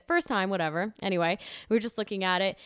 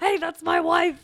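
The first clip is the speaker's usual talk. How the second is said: mock jealous shout; 8 semitones higher, 3 dB louder, slower than usual. Joining the jealous-husband bit.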